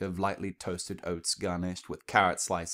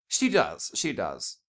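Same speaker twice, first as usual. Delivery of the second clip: Rarity's lilting reply; 3 dB louder, slower than usual. Acted.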